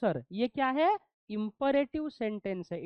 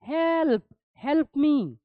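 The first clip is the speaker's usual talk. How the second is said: cry for help; 4 semitones higher, 7 dB louder, much slower than usual. Shown as a demonstration. Example of a sudden exclamation.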